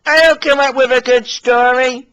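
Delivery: high, loud, indignant little engine. Acted. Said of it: "This made Billy cross".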